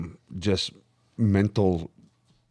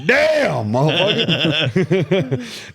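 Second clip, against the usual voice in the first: laughing curse; 4 semitones higher, much slower than usual. Retold or imitated. Retold reaction to belt.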